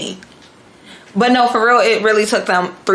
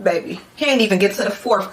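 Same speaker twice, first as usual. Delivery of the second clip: normal talking voice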